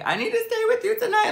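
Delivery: high, fast, squeaky sobbing plea; crying plea. Retold or imitated. Begging her friend Tank on the phone, crying high and fast. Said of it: "I'm literally crying".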